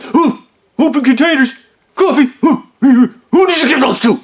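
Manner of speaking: high, loud mock indignation; hammed-up manager huffing between accusations; outraged sputtering. Manager interrogates worker about the coffees, outraged sputtering.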